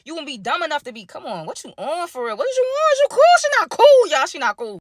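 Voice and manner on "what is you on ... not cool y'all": shocked disbelief. Acted. Reacting to Peyton's messiness.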